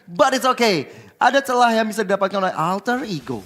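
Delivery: loud, high hype play-by-play; esports caster. Acted. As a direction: ride the teamfight call, building up. Caster calls teamfight excitedly.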